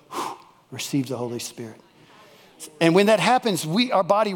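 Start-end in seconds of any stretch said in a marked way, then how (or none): 0.1-1.8 soft blown-out whew, then hushed words; breathy hush. Retold or imitated. God breathing on believers, soft and hushed. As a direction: breathe it out softly like God's breath. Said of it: "he'll show up ... and go".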